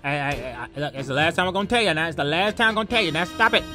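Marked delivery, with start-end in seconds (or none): none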